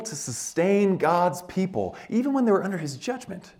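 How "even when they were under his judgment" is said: ordinary delivery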